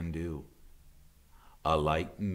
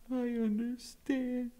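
much higher gosling voice, sniffling; sniffing young goose. Acted. Brightbill to his mother, high and sniffling. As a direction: hold back tears as the little goose. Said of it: "sniffed the goose".

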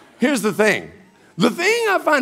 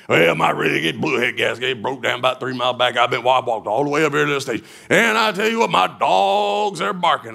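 lightly voiced trucker; 7 semitones lower than usual. Voicing the big trucker.

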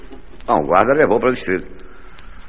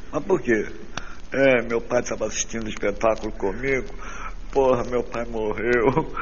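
hushed circus dialogue; 6 dB quieter than usual. Acted.

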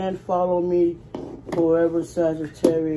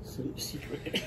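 whisper; 6 semitones lower, 14 dB quieter, slower than usual. Real, not acted. Quiet aside to camera.

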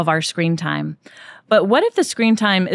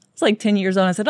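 ordinary talk, nothing marked

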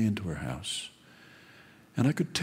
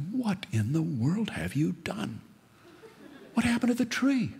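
shocked wife; 11 semitones higher than usual. Acted. Wife upset at cut tree.

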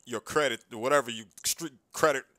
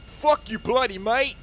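mocking British accent; 6 semitones higher than usual. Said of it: "You're faking it this whole time".

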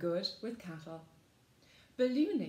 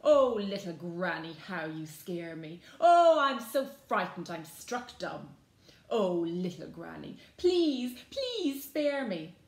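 loud mock fear, leaning on each "oh"; nasty sneer; 9 dB louder than usual. A narrator's reading. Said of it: "to answer with a nasty sneer".

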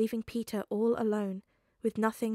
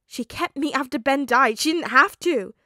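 higher and much louder, near shouting; loud upset defense; 5 semitones higher, 13 dB louder than usual. Acted. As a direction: defend her at full pitch. Peter defends May.

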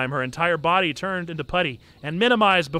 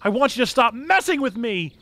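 shouting; 6 semitones higher, 4 dB louder than usual. Acted. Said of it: "Dan shouted".

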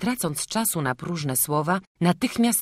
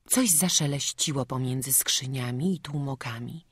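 ordinary delivery

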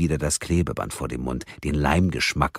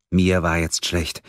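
ordinary delivery

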